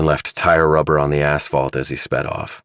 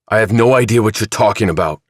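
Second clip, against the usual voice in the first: fast, louder heated denial; heatedly; 5 semitones higher, 4 dB louder, faster than usual. Acted. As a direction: rush it out, getting hot. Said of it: "said Chilton heatedly".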